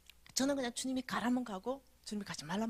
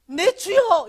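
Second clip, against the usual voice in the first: loud, high call to the Lord; fervent cry; 10 semitones higher, 18 dB louder, much slower than usual. Retold or imitated. Her mouth crying to the Lord against her will, loud fervent call. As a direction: cry out in sudden fervent prayer.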